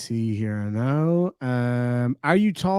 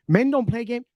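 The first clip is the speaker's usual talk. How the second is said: "Men don't play game" repeated higher in mock disbelief; 8 semitones higher than usual. Streamer mocking a chat comment in a higher voice. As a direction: echo the quote higher as if ridiculing it.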